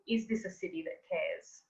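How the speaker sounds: usual speaking voice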